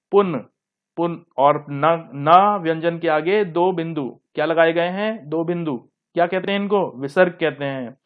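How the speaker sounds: ordinary delivery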